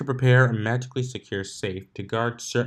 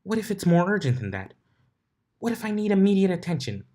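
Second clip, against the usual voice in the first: put-on higher, fast whiny dragon kid; grumbling Spike; 10 semitones higher, 4 dB louder, faster than usual. A narrator's reading. Little dragon whining to Twilight about needing attention. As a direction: whine it like a bored little dragon. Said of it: "Spike grumbled".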